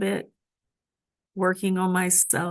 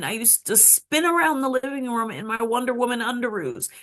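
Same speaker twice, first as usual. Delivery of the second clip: ordinary talk, nothing marked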